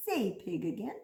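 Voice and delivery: low, soft prompt to repeat; low coaxing. Shown as a demonstration. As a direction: coax them gently in a low, quiet voice. Coaxing children to repeat a word.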